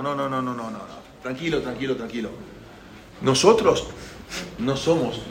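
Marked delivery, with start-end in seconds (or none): 0.0-2.3 low, soft reassurance; calming. Acted. Moshe calms the shouting Pharaoh, soft and calming. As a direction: bring it down low and soothe him.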